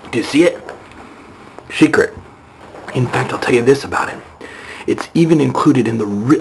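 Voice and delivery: usual speaking voice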